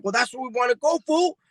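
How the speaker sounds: high put-on voice, climbing on fool; mocking retort. Friend answering the trail tip, high teasing voice. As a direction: toss it back high and teasing. Friend quoted, mock scolding.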